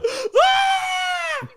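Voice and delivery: very high-pitched burst of laughter. Real, not acted. Co-host cracking up at a rival accent, high laugh.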